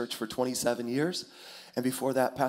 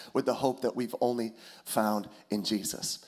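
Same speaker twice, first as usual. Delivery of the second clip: normal talking voice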